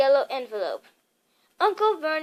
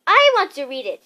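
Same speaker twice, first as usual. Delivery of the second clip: furious; 2 semitones higher, 9 dB louder than usual. A narrator's reading. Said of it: "said Harry furiously".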